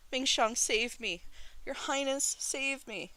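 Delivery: very high, desperate cries for rescue; wailing plea. A narrator's reading. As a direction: wail for help as you're dragged off. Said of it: "Shi Qingxuan wailed".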